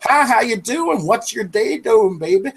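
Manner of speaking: high, drawn-out sing-song Canadian greeting. Acted. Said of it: "they think every Canadian is gonna be like".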